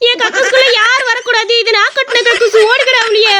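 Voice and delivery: haunt them in a high ghostly voice; the toilet ghost scaring the boy in a high shrill voice. Acted.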